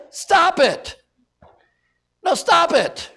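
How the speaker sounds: high, loud stop it; stern command. Real, not acted. Preacher ordering the church to stop, loud and high. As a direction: bark it like an order.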